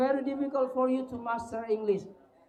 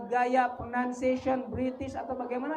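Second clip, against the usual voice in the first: ordinary talk, nothing marked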